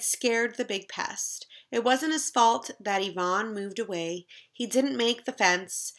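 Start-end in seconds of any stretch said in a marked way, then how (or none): none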